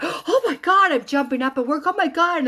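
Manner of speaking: high, loud amazed cry; retold excited outburst. Widow at work realizing her sign came, loudly amazed. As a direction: exclaim it high and breathless with amazement.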